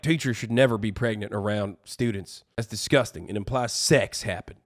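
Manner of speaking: low, quiet deadpan complaint; understated mocking disapproval; mock disgust. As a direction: parody prudish revulsion, low and muted. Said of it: "It's the same energy as being like".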